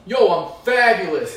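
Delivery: loud, drawn-out mock entrance; Italian Fred swagger. Comic imagining Italian Fred walking in, loud showy greeting. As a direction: announce yourself loudly, slow and showy. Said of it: "an Italian Fred walking in".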